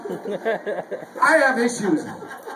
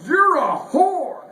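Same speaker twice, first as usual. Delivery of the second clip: higher, slow, louder mock outburst; furious typed insult; 8 semitones higher, 4 dB louder, much slower than usual. Comedian typing an insult at the PayPal bot, slow mock fury. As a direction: spit it out like a furious customer. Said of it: "and I'm furious".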